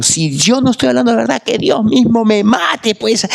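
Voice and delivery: Peter swearing his life on it, pleading; desperate Peter. Pretend. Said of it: "él está diciendo". (Pedro desesperado jurando, agudo y lento)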